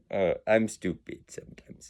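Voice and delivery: very soft, near-whispered mumble; self-deprecating aside. Real, not acted. Admitting a fault after a laugh, hushed aside. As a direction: drop it almost to a whisper.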